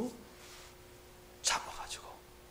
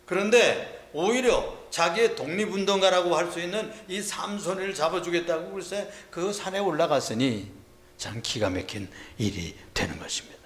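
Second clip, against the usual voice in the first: normal talking voice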